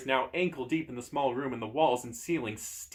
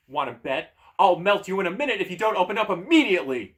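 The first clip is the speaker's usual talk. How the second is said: threatening shout, building louder and higher; rising, forceful yell; 7 semitones higher, 9 dB louder than usual. Acted. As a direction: shout it as an ultimatum. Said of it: "you shout".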